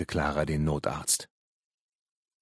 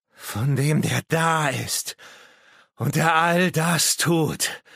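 high, slow, drawn-out proclamation; fervent madman; 10 semitones higher, 5 dB louder, slower than usual. Acted. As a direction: proclaim it loudly like a crazed prophet. Madman proclaims his dark master. (irre, hoch und gedehnt verkündend)

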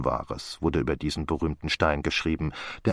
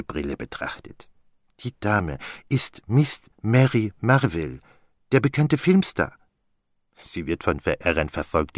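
put-on amused detective with a French accent; Poirot. Explaining the street scene to his friend, as an amused Poirot.

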